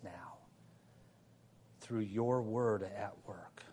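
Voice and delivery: low, hushed prayer with long pauses. Real, not acted. Preacher asking God to excel in grace, hushed prayer. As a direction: pray softly and reverently, phrase by phrase.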